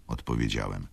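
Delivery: usual speaking voice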